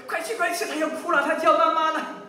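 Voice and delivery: high, hurried nudge; urging. Retold or imitated. Lazy father retells urging his wife to the child, urging.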